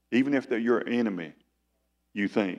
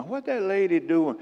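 a much higher, puzzled busybody voice; mock onlooker; 10 semitones higher than usual. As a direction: mimic a nosy churchgoer judging her. Mocking onlooker at twirling wife.

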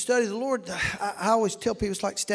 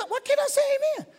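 puzzled; much faster than usual. Retold or imitated. Puzzled inner voice.

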